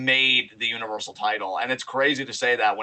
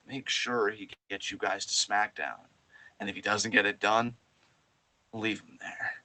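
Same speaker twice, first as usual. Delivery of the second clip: cold Roman order; 5 semitones lower, 8 dB quieter than usual. Retold or imitated. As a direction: lower and softer, menacing calm command. Roman quietly ordering the Usos.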